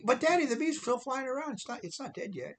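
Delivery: put-on high childlike voice of a scared little girl. Little daughter warning dad from back seat, high child voice. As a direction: pitch way up like a six-year-old calling her daddy.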